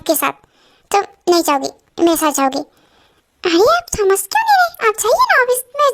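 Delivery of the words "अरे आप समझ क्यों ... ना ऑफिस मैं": exasperated pleading. Acted. Wife pleads with upset husband.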